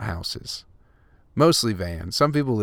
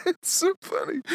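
laughing. Real, not acted. Still laughing.

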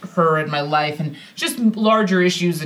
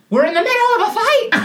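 high-pitched, rapid hammed-up yell; imagined outburst, far above normal pitch; mock scream. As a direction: screech it in comic outrage. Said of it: "don't think we screamed".

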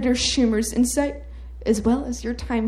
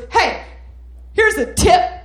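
louder, higher mock-gruff senator voice; mock barked warning; 8 semitones higher, 6 dB louder than usual. Senator snapping at aide over bread plan, loud mock-gruff. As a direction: snap it at him like a scolding boss. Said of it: "he goes".

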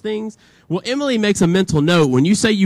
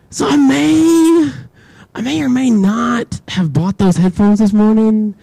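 sheepish confession; 4 semitones higher, 4 dB louder, slower than usual. Retold or imitated.

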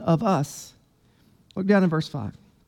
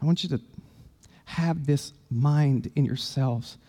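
quiet plea; 7 semitones lower, 8 dB quieter than usual. Acted. Quiet, tender paraphrase of Paul.

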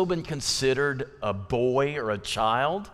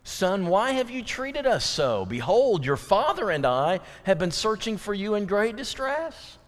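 Mary's question; 6 semitones higher than usual. Retold or imitated. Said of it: "what is the tone of that question?".

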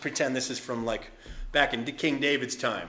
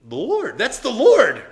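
rising, much louder cry of recognition; excited recognition; 9 semitones higher, 14 dB louder than usual. Retold or imitated. Disciples spotting Jesus on the shore, excited loud cry. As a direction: shout as you realise who it is.

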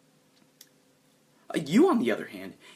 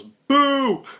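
high, loud drawn-out heckle; heckler's yell; 14 semitones higher, 10 dB louder than usual. Acted. Guy in the crowd after nothing happens, yelled jeer. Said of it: "Another guy yells".

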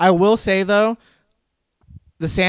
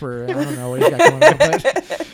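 laughing; 11 semitones higher, 5 dB louder, faster than usual. Real, not acted. Joking that Tom checked out.

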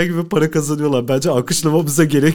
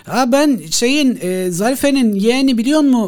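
eager phone caller; 7 semitones higher, 4 dB louder than usual. Acted. Caller introduces himself.

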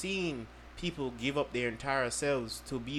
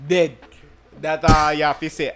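loud; 4 semitones higher, 15 dB louder than usual. Real, not acted. Shouting punchline.

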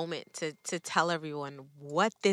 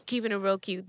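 normal talking voice

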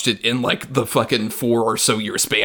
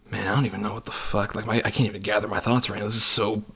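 quietly dumbfounded, retelling it; retold daze; 6 dB quieter than usual. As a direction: drop the volume, recalling a dazed reaction. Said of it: "I walked out in a fucking daze".

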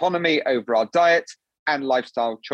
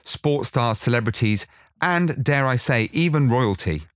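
podcast intro narration; 3 semitones lower than usual. Acted. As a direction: read it like a polished show opening. Produced podcast intro read.

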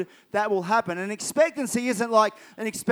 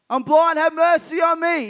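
high, loud plea; yelling out; 6 semitones higher, 5 dB louder than usual. Acted. Blind man pleads with Jesus for mercy, yelling out high. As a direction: call out over a crowd for mercy. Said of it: "He's yelling out".

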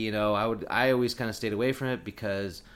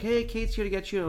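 high sing-song call from mom; a high-pitched imitation of mom calling; 11 semitones higher, 3 dB louder than usual. Mom calls up to announce Kate.

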